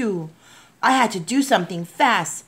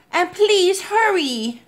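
high, slow, stretched-out urging; yelled plea; 11 semitones higher, slower than usual. A narrator's reading. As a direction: yell it as a desperate plea. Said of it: "I yelled".

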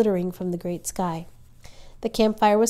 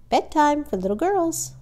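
higher, singsong motherly cheer; cheerful, lilting higher voice; 8 semitones higher, 3 dB louder than usual. A narrator's reading. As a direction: call the children cheerfully to bed. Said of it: "Ma said cheerfully".

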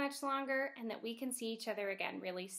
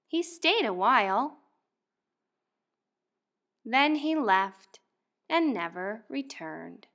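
louder, lively picture-book read-aloud; reading to children; 12 dB louder, slower than usual. Acted. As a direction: read it out to a class of first graders. Teacher reads picture book aloud.

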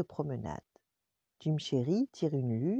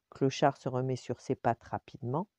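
ordinary delivery, nothing marked

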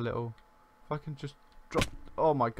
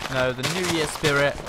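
usual speaking voice

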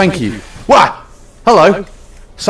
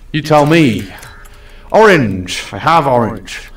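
usual speaking voice

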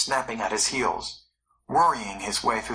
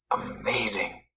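the assistant gasps in wonder at the find; gasped; 3 semitones lower than usual. A narrator's reading. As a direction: breathe it out in astonishment. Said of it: "gasped his assistant".

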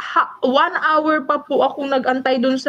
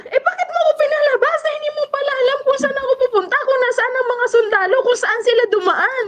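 much higher, fast and laughing in disbelief; half-laughing protest, rattled off quickly; incredulous complaint, high and rushed; exasperated retort; 10 semitones higher, faster than usual. Retold or imitated.

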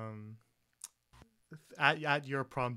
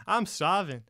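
loud, higher moan of hunger; hungry complaint; 7 semitones higher, 9 dB louder than usual. Acted. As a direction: announce you're starving. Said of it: "starts to walk inside".

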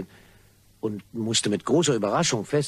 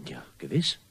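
hushed unvoiced reply; whisper; 8 semitones lower, 5 dB quieter than usual. Caller whispering to the nervous gardener. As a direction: breathe it secretly into the phone. Blackmailer whispers confirmation on phone.

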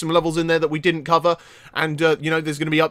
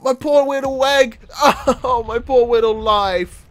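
mock self pity; 6 semitones higher than usual.

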